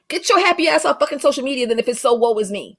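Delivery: ordinary talk, nothing marked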